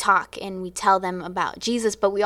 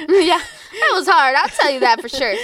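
laughing; 8 semitones higher, 5 dB louder than usual. Real, not acted. Answering the interviewer about the hard year, laughing.

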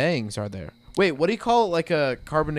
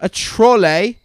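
put-on British accent; 6 semitones higher, 8 dB louder, slower than usual. Mock British repeat.

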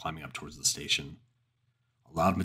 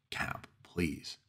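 pleading whisper; 6 dB quieter than usual. Acted. Pleading with captain.

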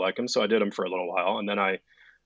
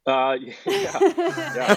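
embarrassed laugh; 8 dB louder, much slower than usual. Real, not acted. Embarrassed laugh about the TikToks.

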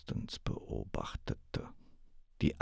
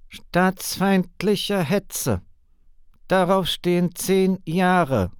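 high, loud, slow snarl; impatient snarl; 11 semitones higher, 6 dB louder, slower than usual. Acted. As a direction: hiss the threat out impatiently. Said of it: "fauchte der Mann ungeduldig zurück".

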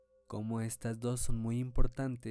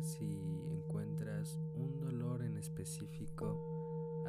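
calm, unhurried meditation guide; guided meditation; 7 dB louder than usual. Acted. Leads a meditation. (voz de meditación guiada)

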